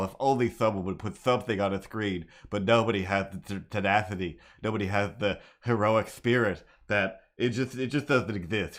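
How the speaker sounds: ordinary talk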